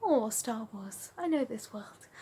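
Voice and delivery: slow hushed whisper of settling in; dreamy whisper. Retold or imitated. Fan slipping into her comfort film, whispered. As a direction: breathe it like a cosy secret. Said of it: "I slip into that, like".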